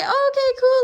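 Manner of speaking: high, bright mock-cheery okay cool; sing-song fake cheer; mock chipper reply. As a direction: chirp it back, fake upbeat. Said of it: "you're like".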